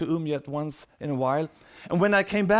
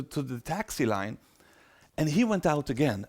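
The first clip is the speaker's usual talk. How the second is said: ordinary talk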